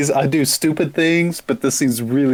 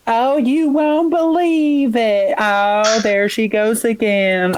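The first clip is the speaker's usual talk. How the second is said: put-on Gossip Girl voice; 8 semitones higher than usual. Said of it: "they all talk like the classic, uh, Gossip Girls".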